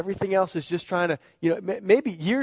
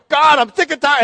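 loud, high exasperated complaint; complaining to God; 9 semitones higher, 8 dB louder than usual. Retold or imitated. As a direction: throw it at God, fed up. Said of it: "You know".

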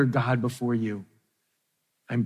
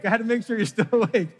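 laughing; 7 semitones higher, faster than usual. Real, not acted.

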